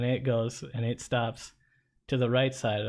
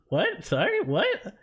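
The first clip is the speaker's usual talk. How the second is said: high, bewildered "what"; can't believe what he's hearing; stunned disbelief; 10 semitones higher, slower than usual. Retold or imitated. Cyclist retelling his baffled reply to the cop, pitched high. Shocked at the cop's order.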